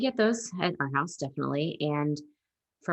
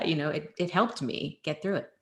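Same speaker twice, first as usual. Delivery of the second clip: normal talking voice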